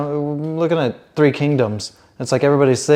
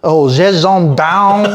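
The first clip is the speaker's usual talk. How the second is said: loud, slow mock-French drawn-out name; hammed-up French accent; 5 semitones higher, 7 dB louder, slower than usual. Trying the name in French for a friend, exaggerated French accent. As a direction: overblown French "zheh-zhon-paown". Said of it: "Try to say it in the French accent".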